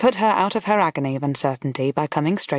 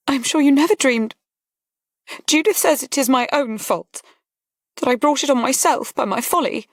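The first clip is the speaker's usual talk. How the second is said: put-on higher, tearful young lady pleading; young lady blaming herself, higher; distressed young woman; 8 semitones higher than usual. A narrator's reading. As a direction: voice Harriet close to tears. Voices Harriet, distressed.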